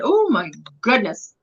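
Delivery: ordinary delivery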